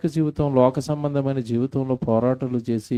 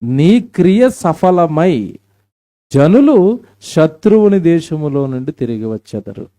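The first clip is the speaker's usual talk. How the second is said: slow, loud and much higher; fervent scripture proclamation; 5 semitones higher, 9 dB louder, slower than usual. Acted. Preacher urging weeping girls, slow and loud. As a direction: stretch the verse out as a loud cry. Reads verse aloud.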